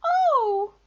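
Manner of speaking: high, loud startled squeak; squeaky Piglet. A narrator's reading. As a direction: let out a tiny creature's alarmed cry. Piglet realises their mistake.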